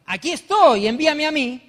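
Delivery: loud, high, eager declaration; enthusiastic. Retold or imitated. Preacher recalls volunteering to be sent, enthusiastic. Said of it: "yo entusiasmado le dije".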